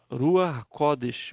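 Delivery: Ruach Kodesh said slowly, syllable by syllable; slow model phrase. Shown as a demonstration. Teacher slowly modelling Ruach Kodesh for students. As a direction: draw out Ruach Kodesh for the class. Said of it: "a palavra ruach".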